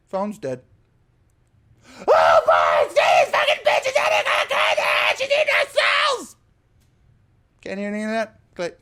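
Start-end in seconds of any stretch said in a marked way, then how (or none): 2.1-6.4 screaming on the phone. Retold or imitated. Imitating the muffled phone screams the caller hears, hammed-up panic. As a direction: squawk it like a garbled panic down a phone line. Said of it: "I can hear the screams from the telephone".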